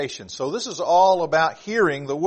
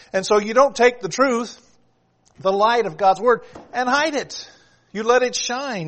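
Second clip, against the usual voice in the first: raised pitch, forceful sermon delivery; emphatic preaching; 5 semitones higher, 4 dB louder than usual. Acted. Preacher telling congregation not to hide the truth, emphatic preaching. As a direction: drive it home like a preacher.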